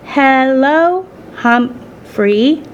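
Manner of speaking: high, drawn-out, careful greeting; halting learner's attempt. Mother practising English on the hamster, slow and halting. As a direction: sound out each syllable tentatively. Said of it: "Saya gently instructed her mother".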